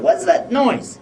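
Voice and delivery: high, startled what's that noise; startled question. Retold or imitated. Waking on the masjid floor, startled by sound. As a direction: jolt awake and ask. Woken in dark mosque.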